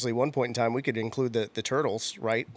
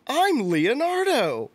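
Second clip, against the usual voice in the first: high, drawn-out hero announcement; Brian Tochi; 12 semitones higher, 3 dB louder, much slower than usual. Retold or imitated. Actor revealing his turtle role to a little girl, high and drawn out. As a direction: proudly announce yourself, high and slow. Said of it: "And he goes".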